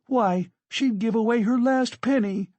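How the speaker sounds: high, heated protest; indignant defence. Acted. Katerina defends Sonia hotly.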